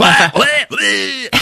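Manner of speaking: high strangled mock gasps; choked gasps. Hux being force choked, high choked gasps. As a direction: gasp it while being choked. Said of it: "he's like".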